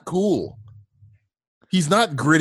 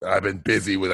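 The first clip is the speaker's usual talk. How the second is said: deep mock movie voice; 8 semitones lower, 4 dB quieter than usual. Deep imitation of movie line.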